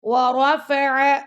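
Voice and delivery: ورفع with a full, heavy ra. Shown as a demonstration. Teacher demonstrating heavy ra. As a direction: fill the mouth on the ra of ورفع. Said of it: "را کو پر پڑھنا ہے آپ نے".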